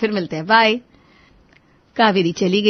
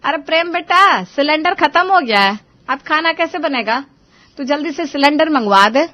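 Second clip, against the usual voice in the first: higher, louder call to her son; mother calling her son about the empty cylinder; 7 semitones higher, 4 dB louder than usual. Acted. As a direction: call out from the kitchen. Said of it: "Savitri ने प्रेम को आवाज दी".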